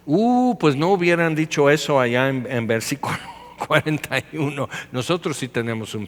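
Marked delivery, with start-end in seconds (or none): 0.1-0.6 high, drawn-out "uuh"; a teasing, high-pitched ooh of provocation; mock "ooh". Reacting to Jesus calling them liars, mock scandalized.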